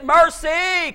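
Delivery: high, ringing plea; crying out. Retold or imitated. Preacher as Canaanite woman calling to Jesus, crying out. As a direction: re-enact a mother's cry for mercy. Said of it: "Cry out".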